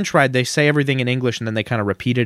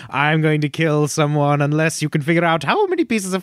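hammed-up comic-book villain threat; put-on villain voice; Riddler; 3 semitones higher than usual. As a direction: pose a deadly riddle like a theatrical villain. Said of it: "where the Riddler's like".

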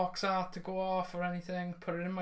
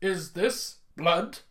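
Barry impression; much slower than usual. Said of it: "and Barry's going".

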